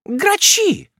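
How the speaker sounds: put-on high, sharp interrogating old aunt; questioning. A narrator's reading. Miss Betsey questioning the young widow sharply. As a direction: grill her like a stern aunt. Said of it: "допрашивала мисс Бетси".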